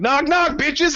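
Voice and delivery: high, cocky knock knock; mock swagger; cocky drunk. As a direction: announce the entrance, high and cheeky. Drunk player bursting in.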